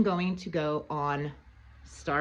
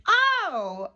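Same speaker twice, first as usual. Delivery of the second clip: high fake-innocent oh; mock surprise; 12 semitones higher, 3 dB louder than usual. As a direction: act surprised, pitched up. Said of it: "And I was like".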